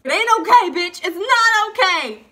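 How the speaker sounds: high, loud mock outburst; hammed-up indignant protest; outraged retort. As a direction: yell it back, stretching the last words. Acted outburst back at the teacher.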